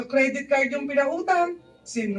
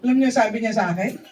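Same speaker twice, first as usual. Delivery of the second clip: ordinary talk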